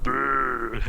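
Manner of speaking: rolling mock growl, voiced up; imitated growl. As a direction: buzz out a silly Trundle rumble. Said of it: "the whole time went".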